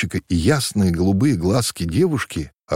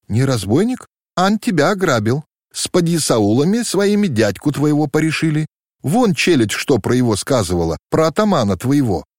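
higher, mildly sharp rash outburst; sharp retort; 7 semitones higher than usual. A narrator's reading. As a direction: blurt it out sharply without thinking. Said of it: "Необдуманно и резко сорвалось с уст Агаши". (резко, необдуманно, выше)

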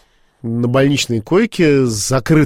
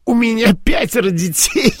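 much higher, a retold stunned echo; astonished repeat; 10 semitones higher than usual. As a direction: replay his own amazement at the news. Repeating the doctors' news to himself. (изумлённо, гораздо выше)

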